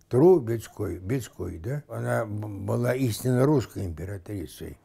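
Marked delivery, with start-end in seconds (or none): 0.1-1.0 "Трубецкой" with a loud, separated first syllable "Тру"; first syllable set apart. Shown as a demonstration. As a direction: punch the "Тру" in "Трубецкой", then break before the rest. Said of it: "отнимался первый слог фамилии". (выделить первый слог «Тру» в слове «Трубецкой»)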